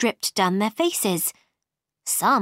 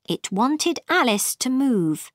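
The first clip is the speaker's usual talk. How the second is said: ordinary delivery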